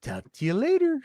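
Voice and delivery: high, eerie put-on goodbye with a cackle; creepy sign-off. Ending the creepy voicemail with a high cackle.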